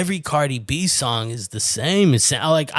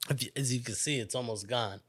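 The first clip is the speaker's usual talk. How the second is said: slightly tipsy mumble; 3 semitones lower, 11 dB quieter, faster than usual. Real, not acted. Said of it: "I'm a little drunk off of it".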